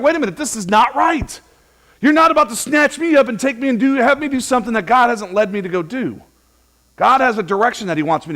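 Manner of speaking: loud, high, firm refusal of the crowd; Jesus refusing to be made king, preached at full force. Acted. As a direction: push back like a man who won't be taken.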